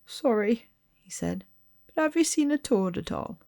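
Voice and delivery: put-on small timid boy close to tears; tearful boy. A narrator's reading. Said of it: "He looked tearful".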